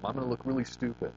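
ordinary delivery

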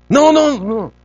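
loud, high-pitched repeated no's; intense parental no. Acted. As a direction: parent urgently stopping a kid. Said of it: "a little more high intense".

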